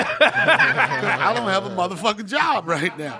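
loud, slow, laughing punchline. Real, not acted. Guest joking about vacations, loud slow laughing punchline. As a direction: hammer the punchline while laughing.

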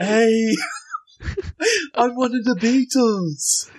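high put-on Liverpool voice, laughing through it. Mocking [name] as [name], laughing in a high Scouse voice.

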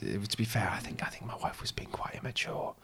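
whispered aside; hushed confiding. Acted. Husband griping about wife who might be listening, whispering. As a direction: drop to a secretive whisper.

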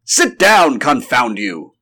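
blustering doctor ordering his friend back; exasperated cry. Acted. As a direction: cry out to stop him leaving. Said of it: "cried the doctor".

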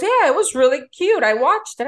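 high, loud gushing reply. Retold or imitated. Priya texting back, gushing. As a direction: bubble over about the movie.

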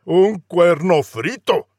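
lightly voiced outraged prince; indignant prince. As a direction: spit out the refusal. Prince retorts.

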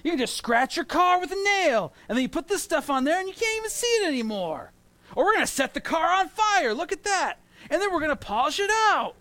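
high, loud, hyped-up TV salesman; infomercial pitchman. Retold or imitated. Pastor mimicking TV ads to the congregation, hyped pitchman voice. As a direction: sell it like a late-night infomercial host. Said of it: "you ever see the stuff where it's like".

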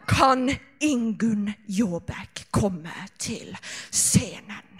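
very slow, low, hammed-up seduction; sensual voice. Performer summoning a friend to the stage, mock sensual voice. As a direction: purr it slowly into the microphone. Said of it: "sensuell stemme".

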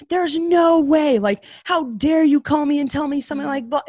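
high, loud distraught yell. Retold or imitated. Daughter yelling at her father's friend on the phone. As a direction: shout the news away in disbelief. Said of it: "like, yelling".

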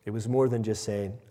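normal talking voice

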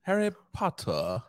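high mock British accent. Shown as a demonstration. Joking about practising the accent, high British accent. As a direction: pitch it up into amateur British. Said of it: "You have to practice to make it perfect".

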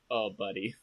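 soft, low sympathy; gently consoling a friend; tender. Retold or imitated. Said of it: "and Yoda's like".

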